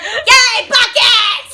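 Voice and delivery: high, loud, gleeful; squealing over buckets; mock glee. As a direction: cheer like a thrilled toddler.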